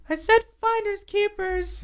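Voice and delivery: very high, squeaky little creature; indignant kobold. Acted. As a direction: protest in a tiny high kobold voice. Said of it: "the kobold turns to you and is like".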